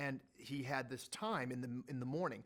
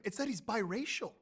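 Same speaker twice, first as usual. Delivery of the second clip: ordinary talk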